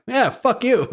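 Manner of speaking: mock taunt. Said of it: "And you're like".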